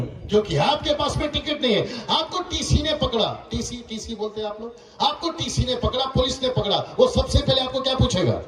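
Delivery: urgent, ringing sermon parable; emphatic preaching. Real, not acted. Preacher warning the crowd through a ticket parable, preaching. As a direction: hammer out the questions to the congregation.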